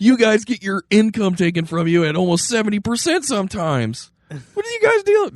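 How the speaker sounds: high-pitched comic indignation, punched out; mock-outraged founding father. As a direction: protest like an appalled founding father. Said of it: "Right after Jefferson died, they would have been like".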